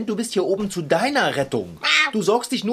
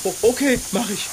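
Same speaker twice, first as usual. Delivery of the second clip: ordinary talk, nothing marked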